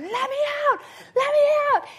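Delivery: high, loud imitated panic; mock panicked yell. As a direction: play the girl freaking out in the capsule. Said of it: "she freaked out and started going".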